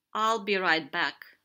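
loud, quick-parting call; shouted. A narrator's reading. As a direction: shout it as you head off. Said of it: "little Sea Racehorse shouted".

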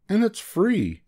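high, cheery little bug; merry bug. A narrator's reading. Bug welcoming the bird, merrily. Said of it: "laughed the bug".

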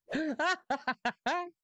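short high-pitched laugh; real amused chuckle. Real, not acted. Laughs after repeating the lyric.

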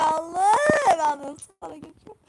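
losing gamer's tearful prayer; crying prayer. Real, not acted. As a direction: cry out to God half-sobbing. Losing, cries out to God.

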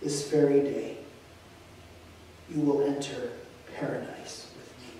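soft fading whisper; dying man whispering softly to the thief. A narrator's reading. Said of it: "Jesus whispered".

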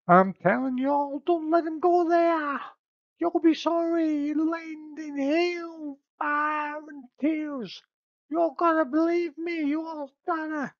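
a frantic seer pleading to be heard; shrill prophetess. Acted. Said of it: "Cassandra comments".

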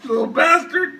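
high laughing yells; hysterical shouting. Real, not acted.

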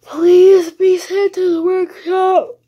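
high, loud, slow begging; pleading cry. Real, not acted. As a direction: beg it out loud like a wail.

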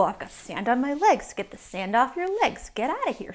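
ordinary delivery

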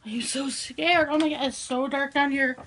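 ordinary talk

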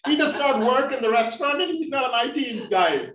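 high, loud, hammed-up astonishment; mock amazement. Comedian amazed an Indian man works in engineering, mock-excited. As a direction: exclaim as if witnessing a rare wonder. Acts amazed officer hearing an unexpected job.

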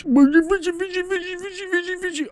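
very high rapid chant; frantic squeal. Real, not acted. Gamer frantically reeling in a fish, high squealed chant.

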